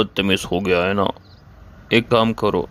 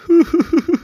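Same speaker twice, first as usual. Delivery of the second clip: high, louder pained half-laugh; strained laugh; 5 dB louder than usual. Acted. Baba ji, upset by the rude son, a high pained laugh. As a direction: let out a high strangled laugh as you feel unwell. Baba ji overcome after quarrel.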